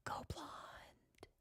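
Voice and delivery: hushed tempting whisper, no voice; devil whisper. Acted. The devil in her head tempts her in a whisper. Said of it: "it whispers to me".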